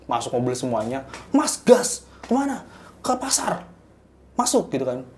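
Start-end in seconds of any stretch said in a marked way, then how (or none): 1.3-4.7 high shouted orders to drive; urgent shouting. Retold or imitated. Passengers yelling at the driver to step on the gas, high and urgent. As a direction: yell at the driver to floor it.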